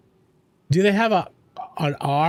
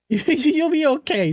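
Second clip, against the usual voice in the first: laughing; 7 semitones higher, slower than usual. Real, not acted. Laughing reassurance to sad chat.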